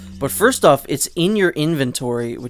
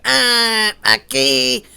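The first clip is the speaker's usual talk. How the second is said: high-pitched goofy villager; 10 semitones higher, 5 dB louder, much slower than usual. Retold or imitated. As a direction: squeal it high and loud. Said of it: "guy with the high-pitched voice".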